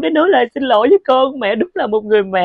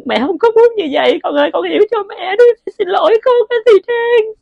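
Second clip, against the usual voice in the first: mother begging forgiveness, building to a tearful high cry; rising tearful plea; 10 semitones higher than usual. Acted. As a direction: pitch climbing higher into a tearful cry. Mother begs forgiveness, voice climbing.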